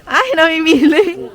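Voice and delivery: loud, high exclamation at picky eaters; exasperated. Real, not acted. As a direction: throw up your hands, loud.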